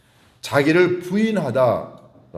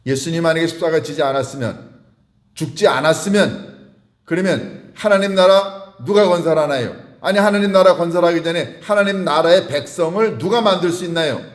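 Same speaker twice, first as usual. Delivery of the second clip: raised pitch, driving rhetorical questions; impassioned preaching; 6 semitones higher, 5 dB louder than usual. Real, not acted. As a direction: challenge the congregation with mounting urgency. Preacher presses rhetorical questions on congregation. (열변을 토하는 설교조)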